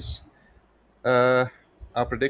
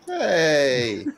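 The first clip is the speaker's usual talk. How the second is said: laughing protest; 5 semitones higher, 5 dB louder than usual. Real, not acted. Mock protest after friend joke.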